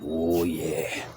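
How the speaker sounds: low, slow put-on cool voice; mock smooth voice. Man retelling joking to his cousin, low and slow. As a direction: purr it like a smooth operator. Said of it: "I was like".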